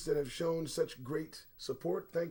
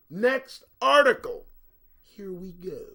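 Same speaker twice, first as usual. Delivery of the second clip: announcer call; 3 semitones higher, 9 dB louder than usual. Real, not acted. Host announcing next segment.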